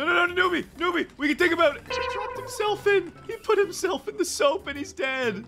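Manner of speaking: high-pitched gleeful outburst; excited disbelief. Real, not acted. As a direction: squeal at a rival's blunder. Opponent kills himself in soap.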